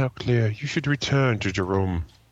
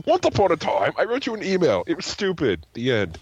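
storybook voice; 7 semitones higher than usual. Pretend. Mock storybook telling.

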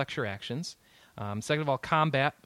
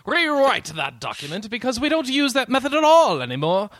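higher, louder pompous boss; stuck-up editor voice; 9 semitones higher, 7 dB louder than usual. Retold or imitated. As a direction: read it like a haughty editor. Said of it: "poignant and stuck up".

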